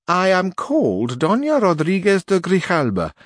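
a stiff duenna introducing herself; old duenna answering Sancho, high and prim; haughty old duenna. Acted.

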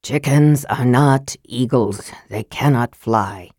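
low, measured, curt mage; weary Raistlin. Acted. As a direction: end the discussion in a low voice.

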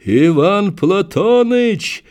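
high, slow desperate call; man down shout. A narrator's reading. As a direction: cry out in a voice not his own. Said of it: "закричал он не своим голосом".

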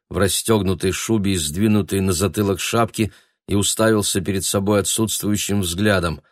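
plain reading voice

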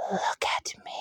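hollow breathy whisper, very soft; creature whisper. Acted. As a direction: lure them in a ghostly whisper. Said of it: "hollow voice whispered".

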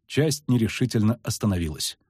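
plain reading voice

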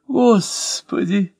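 high, slow stretched-out moan; groaning. Acted. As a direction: groan «Господи!» like a man clutching his head. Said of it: "простонал актёр". (протяжно простонать «Господи!» высоко)

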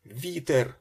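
high, loud cry of alarm; boy calling out. A narrator's reading. Boy warns Ben in the cockpit, called out loudly. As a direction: shout it high and sudden. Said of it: "гукнув хлопець".

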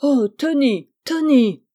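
loud, high cry of dismay; collective outcry. A narrator's reading. Family seeing the dishevelled boy, shocked outcry. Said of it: "un cri s'élève".

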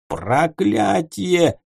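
slow, drawn-out howl. Acted. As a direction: howl the curse in despair. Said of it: "взвыл Ворон".

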